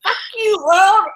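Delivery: mock fury at the world; angry outburst. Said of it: "I think I'm really angry".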